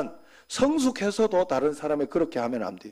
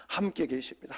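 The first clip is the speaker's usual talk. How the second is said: softer, inward prayer line; quiet prayer; 3 semitones lower, 7 dB quieter than usual. Shown as a demonstration. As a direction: pray it quietly to yourself. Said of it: "하고 하나님을 불러야 돼요". (조용히 기도하듯)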